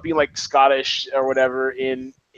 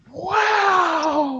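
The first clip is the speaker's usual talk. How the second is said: long, high-pitched wow; amazed; 14 semitones higher than usual. Real, not acted. Host amazed at spotting the actor, astonished.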